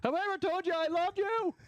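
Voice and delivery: high, gushing mock declaration; mock swoon. Host gushes at the guest over a drink. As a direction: swoon over the cocktail offer. Host delighted by premade cocktail.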